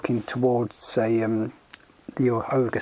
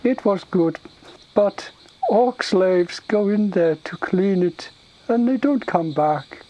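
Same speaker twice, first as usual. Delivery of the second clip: high, slow, halting ogre voice; simple-minded ogre, explaining; 6 semitones higher, 5 dB louder, slower than usual. Acted. Ogre telling the Tauren about the cave, plodding. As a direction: search for each word like a dim ogre.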